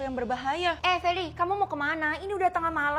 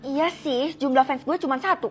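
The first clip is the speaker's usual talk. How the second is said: usual speaking voice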